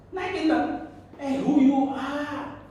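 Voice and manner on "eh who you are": mock arrogant challenge. Mocking arrogant interviewee.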